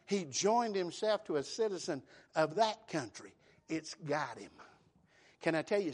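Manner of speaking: ordinary talk, nothing marked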